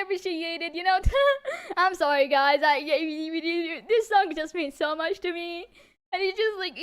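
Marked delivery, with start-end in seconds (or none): 6.1-6.8 tearful. Real, not acted. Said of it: "I will start crying more".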